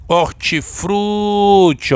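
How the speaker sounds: "hortifruti" with FRU held long and high; stress on FRU. Shown as a demonstration. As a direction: stretch the FRU in "hortifruti", slowly. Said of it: "Qual é a sílaba mais forte?". ("hortifruti" com o FRU bem alongado)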